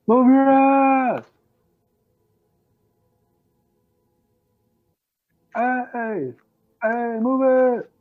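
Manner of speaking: high-pitched impatient yell, drawn out. A narrator's reading. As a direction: holler from the back of the line. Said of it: "starts yelling at".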